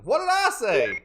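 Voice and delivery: high, loud indignant challenge; mock scolding shout. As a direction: snap it like a warning to teasers. Scolding chat after being teased.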